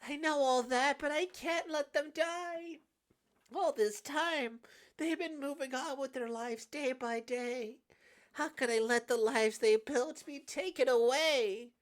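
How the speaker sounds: high, loud, impassioned cries; anguished, high-pitched emotional outpouring; desperate pleading. Acted. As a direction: plead at full voice, straining to hold on. Acted frantic plea as curse escapes.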